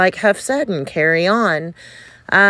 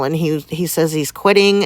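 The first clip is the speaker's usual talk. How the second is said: ordinary talk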